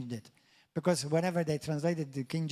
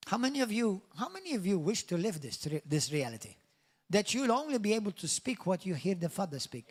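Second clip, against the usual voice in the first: ordinary talk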